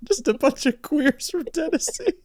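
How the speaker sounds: high, amused repeat; high mocking voice. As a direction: squeak it out in jest.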